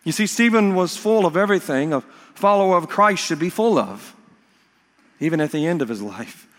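ordinary talk

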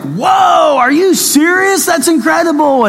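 a hammed-up sarcastic whoa; sarcastic mock amazement. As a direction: gush in mock awe at four cents. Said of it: "And I'm like".

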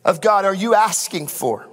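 rising, fervent sermon delivery over the band; impassioned preaching. Acted. Pastor to congregation, fervent preaching. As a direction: preach it out with building conviction.

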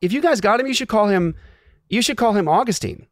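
high, gushing imitation of Desi; gushing friend. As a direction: sing it up high like a sweet churchgoer. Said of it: "She goes".

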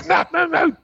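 stifle a yell and a cry, going high; squeaky scared plea, holding back tears; high panicked squeak. Retold or imitated. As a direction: pitch jumps up in strangled panic. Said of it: "trying not to yell and cry".

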